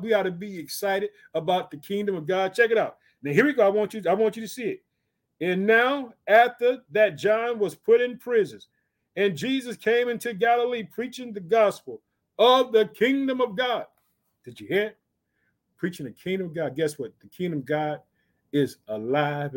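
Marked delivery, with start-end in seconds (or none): 5.4-13.9 raised, deliberate pulpit reading; scripture declamation. Acted. Preacher reads of Jesus coming into Galilee, declaiming. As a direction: announce the verse phrase by phrase.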